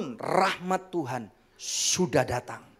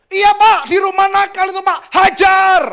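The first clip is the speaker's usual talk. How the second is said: high, loud shouting agreement; harsh parent shout; 12 semitones higher, 11 dB louder than usual. Acted. Parent shouting to the shop owner to beat the child, loud harsh shout. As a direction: yell it like a parent eager to punish.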